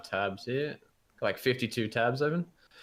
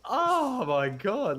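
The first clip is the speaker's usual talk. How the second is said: slow disbelief; 10 semitones higher, 3 dB louder, much slower than usual. Retold or imitated. Recalling gross scene.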